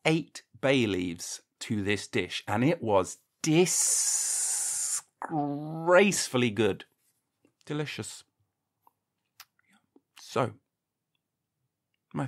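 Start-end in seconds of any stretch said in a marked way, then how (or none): 3.4-6.8 DISGRACEFULLY stretched out very slowly; drawn-out relish. Acted. Praising the curry to listeners, drawn-out relish on "disgracefully". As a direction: linger on each part of "disgracefully", savouring it. Savouring how good the dish was.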